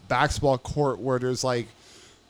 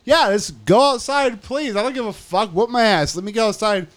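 high-pitched, worked-up retort; defiant pleading; 7 semitones higher, 4 dB louder than usual. Retold or imitated. Inmate begging guard to take him outside, defiantly eager. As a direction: beg for it as a dare. Keeps daring the guard to proceed.

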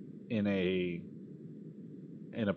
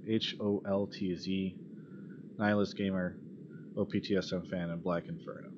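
usual speaking voice

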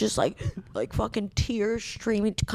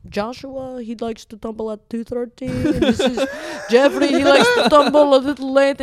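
lightly voiced coworker; 3 semitones higher, 6 dB louder than usual. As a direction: introduce your pigeons fondly. Coworker introduces pet pigeons.

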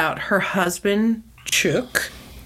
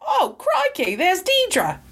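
high hammed-up Australian accent; a jokey Australian accent, pitched up; mock Australian accent; 13 semitones higher, slower than usual. Podcaster jokes about Bill greeting Deidre, mock Australian accent. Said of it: "However they say it".

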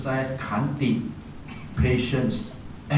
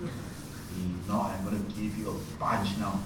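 much softer menacing threat; quiet threat; 4 semitones lower, 9 dB quieter than usual. Retold or imitated. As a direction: threaten him low and soft. Threatening the temple boy.

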